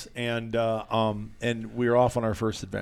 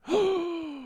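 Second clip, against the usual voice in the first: high, sharp imitated gasp; mock gasp of a buddy spotting a coyote; excited gasp; 5 dB quieter than usual. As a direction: suck in air like a thrilled hunter.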